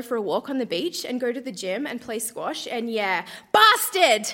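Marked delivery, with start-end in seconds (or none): 3.5-4.3 outburst. Acted. Sudden diary outburst.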